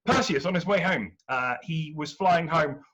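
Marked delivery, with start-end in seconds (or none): none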